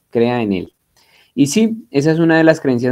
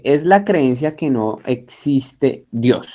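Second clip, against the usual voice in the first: 1 slow dictation; slower than usual. Shown as a demonstration. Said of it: "en la primera línea de ateísmo dice".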